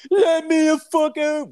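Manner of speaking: high-pitched, loud trapped scream; imitated screaming. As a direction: scream like someone stuck in an elevator. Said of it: "I can hear him banging and screaming".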